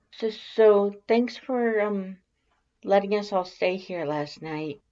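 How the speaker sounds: high, timid stammering voice; nervous stammer. Acted. Shy Alphys flustered about watching you sleep, high stammer. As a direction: stammer it out shyly, voice pitched well up. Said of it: "Alphys stammers".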